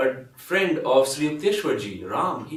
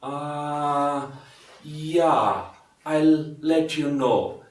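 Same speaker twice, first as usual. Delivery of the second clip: slow, drawn-out reluctant answer; mock evasive reply dragged out slowly; noncommittal stalling; 3 dB louder, much slower than usual. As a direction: stall as if dodging a commitment. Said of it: "they don't want to commit themselves".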